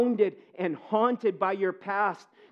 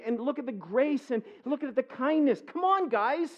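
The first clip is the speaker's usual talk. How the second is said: higher, pleading wounded brother; voicing Joseph, higher and pleading; hurt Joseph; 6 semitones higher than usual. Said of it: "He's like".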